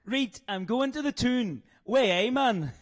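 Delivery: badly imitated Newcastle accent; failed Geordie accent. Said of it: "you just sound like you still".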